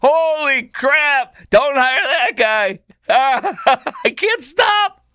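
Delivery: helpless high-pitched laughter through the words; real laughing fit, barely getting words out; laughing hard. Real, not acted. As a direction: squeal it out between laughs. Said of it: "I don't know why I'm laughing so hard".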